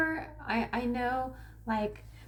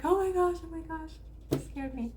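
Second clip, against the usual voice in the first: higher startled gasp of words, easing off; mock startled; 7 semitones higher than usual. Herself scared by a prank but not mad, mock startled. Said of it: "when something scares me".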